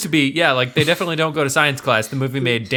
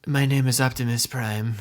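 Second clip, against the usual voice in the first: low, solemn robot-hero impression; deadpan Transformer movie-star impression; Robert Pattinson's Optimus Prime; 3 semitones lower, 4 dB quieter than usual. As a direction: put on a deeper heroic voice, pausing between phrases. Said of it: "a little taste of Robert Pattinson".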